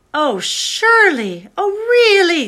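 high, loud, drawn-out cry of disbelief; shocked disbelief. Acted. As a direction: she cannot believe what she hears. Susan shocked at betrayal news.

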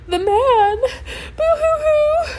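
very high, loud mock sobbing; crying. A narrator's reading. Dwayne telling Cece who is capturing them, wailing. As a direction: squeal the answer through hammed-up sobs. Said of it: "while he continued to cry".

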